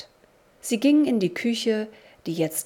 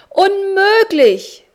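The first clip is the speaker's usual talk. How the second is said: very high, loud and drawn-out "Unmöglich"; loud cry; 12 semitones higher, 12 dB louder, much slower than usual. A narrator's reading. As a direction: cry out in horror at the mess. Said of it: "rief er laut".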